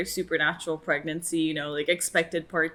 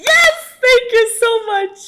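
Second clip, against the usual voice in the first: loud, much higher cheer of delight; joyful cheer; 11 dB louder, much slower than usual. Real, not acted. Fan thanking the show, loud high cheer. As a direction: cheer like your wish came true.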